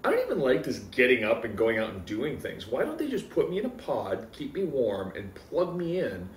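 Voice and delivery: ordinary delivery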